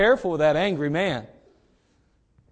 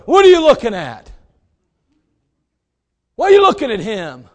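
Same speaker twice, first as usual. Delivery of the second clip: jealous angry guy; 3 semitones higher, 9 dB louder than usual. Acted. Jealous boyfriend challenging whoever he caught looking, acted angry shout. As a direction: act out a crazed boyfriend confronting someone. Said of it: "jealous, crazed guy".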